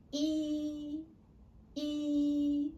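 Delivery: E held long and level, high in pitch; high flat tone. Shown as a demonstration. Teacher reminds pupils of number one, high flat tone. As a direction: keep E high and steady. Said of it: "same tone as number one".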